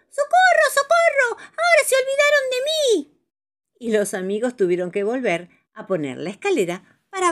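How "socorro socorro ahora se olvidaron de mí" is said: very high, loud desperate cries; shouting for help. Acted. Paco stuck up high calling friends back, shouting. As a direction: yell down from the treetop. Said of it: "Paco grita desde lo alto".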